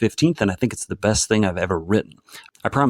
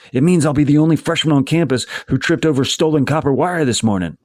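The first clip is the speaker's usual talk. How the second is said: higher, emphatic run-on protest; exasperated complaint; 7 semitones higher, 4 dB louder than usual. A narrator's reading. Niece complaining to uncle about stolen wire, exasperated. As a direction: spell it out to him, fed up. Acted quarrel with her uncle.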